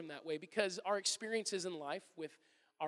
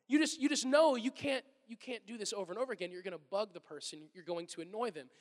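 usual speaking voice

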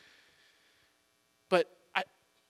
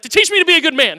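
higher, much louder, annoyed son's recital; mad kid reply; 10 semitones higher, 12 dB louder, faster than usual. Retold or imitated. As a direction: spit it back like a cross little boy. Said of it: "And he'll get mad".

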